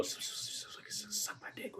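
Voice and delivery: breathy, slow, voiceless whisper of a dirty line; mock movie whisper barely audible. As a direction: hush it right down like a muttered secret. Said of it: "whispering to somebody".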